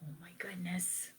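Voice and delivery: a hushed aside to the listeners; whisper. Real, not acted. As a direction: breathe it like a secret.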